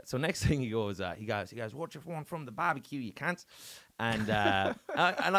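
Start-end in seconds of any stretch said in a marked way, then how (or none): 1.7-3.5 higher, broad Aussie impression; put-on Australian accent. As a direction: imitate an Australian barbecue host. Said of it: "I'm doing this Australian accent".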